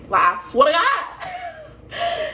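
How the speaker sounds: put-on high yelled plea it better be a boy; mock shout. Mother on the operating table shouting a prayer.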